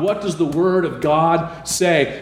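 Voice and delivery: ordinary delivery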